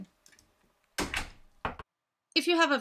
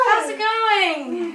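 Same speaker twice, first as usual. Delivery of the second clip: excited greeting; 6 semitones higher, 8 dB louder, much slower than usual. Acted. Greeting a visitor.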